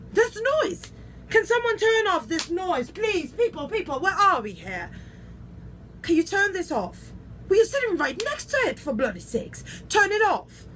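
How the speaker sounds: high, loud, indignant complaint; exasperated demand. Acted. Diva complains to the crew about noise, loudly exasperated. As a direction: order them to stop that noise.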